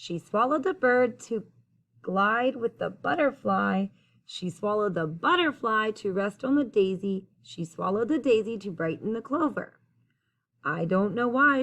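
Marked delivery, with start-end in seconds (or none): none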